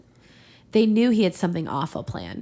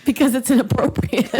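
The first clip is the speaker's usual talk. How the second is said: louder, higher, laughing; 5 semitones higher, 7 dB louder than usual. Real, not acted. Explaining why nobody says Plughorn, laughing. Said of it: "laughing answer".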